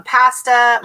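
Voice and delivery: "pasta" with the A of cat; Canadian short A. Shown as a demonstration. Explaining how Canadians use that A, flat-A pasta. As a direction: demonstrate "pasta" with a flat Canadian first vowel. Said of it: "they use that A".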